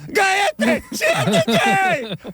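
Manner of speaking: put-on shrill old grandma yelling in Spanish; eerie grandmother impression. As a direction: bark it like a scolding abuela. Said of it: "eerie impression of my grandmother".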